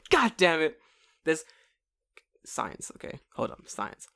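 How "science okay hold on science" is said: softly whispered aside; whispering. Real, not acted. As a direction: hush it, like a secret.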